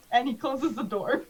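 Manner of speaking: normal talking voice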